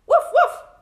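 loud, very high woofs; two sharp, high barks; dog barking. Acted. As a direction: bark like a little storybook dog. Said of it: "barked the dog".

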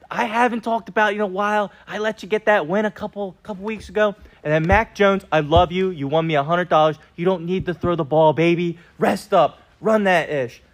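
normal talking voice

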